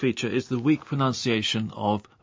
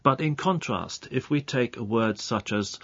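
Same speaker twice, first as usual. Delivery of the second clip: normal talking voice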